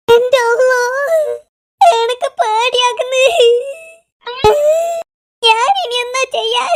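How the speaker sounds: drawn-out frightened wail; hammed-up tearful whine; crying. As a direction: wail in comic panic, stretching the words. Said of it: "നിങ്ങൾ എന്തിനാ കരയുന്നത്".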